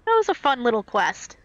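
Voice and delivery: ordinary talk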